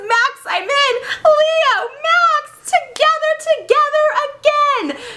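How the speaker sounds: very high, gushing stage cry; theatrical elation. Acted. Leo runs back to Max, theatrical elation. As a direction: burst out high, ending in a long flourish.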